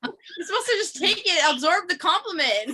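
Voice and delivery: giggling and scolding a friend who won't accept praise; laughing while teasing. Real, not acted. Teasing a shy friend about her compliment, laughing.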